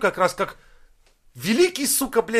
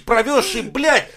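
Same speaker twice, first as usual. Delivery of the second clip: ordinary delivery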